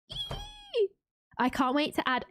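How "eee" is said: very high, soft "eee"; thin squeak of glee; excited squeal. Real, not acted. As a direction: let out a small, high squeal. Excited at seeing new home.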